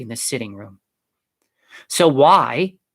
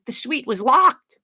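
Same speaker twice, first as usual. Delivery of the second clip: high, slow insistence; raised pitch, drawn-out objection; persistent; 12 semitones higher than usual. A narrator's reading. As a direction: press the point, incredulous. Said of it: "Juliana persisted".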